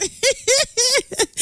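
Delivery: high, giddy swooning giggle. Real, not acted. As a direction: giggle like a smitten fan. Giggling after gushing.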